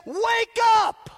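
loud, high shout of wake up; shouted command. Acted. Preacher voicing Paul to sleepers, shouting. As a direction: rouse them like an alarm. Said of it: "he says".

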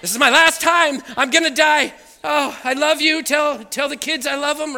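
loud, high mock-desperate farewell; all-caps shouting. Husband texting his wife in caps, melodramatic panic. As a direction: wail last words as if dying. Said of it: "caps on".

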